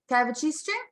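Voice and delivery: put-on high wheedling little-kid voice; toddler voice. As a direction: beg sweetly like a toddler. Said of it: "he'll be like".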